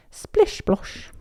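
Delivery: high whispered splish splosh; whispered splash. Acted. Narrator whispering splashes in the mermaid pool. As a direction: breathe the splash sounds.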